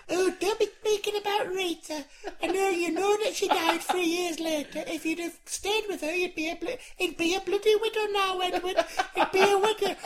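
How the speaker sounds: high grandma scolding; Doreen old lady voice. Pretend. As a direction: nag high like an old wife. Doreen voice again.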